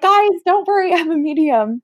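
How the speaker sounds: high-pitched mock reassuring call; nervous reassurance. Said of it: "I was so scared".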